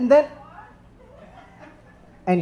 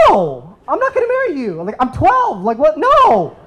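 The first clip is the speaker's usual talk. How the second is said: put-on much higher, indignant girl's voice; imitating the girl; 5 semitones higher than usual. Twelve-year-old girl rejecting his proposal, high and indignant. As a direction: squeak out a scandalized refusal. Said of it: "And she's like".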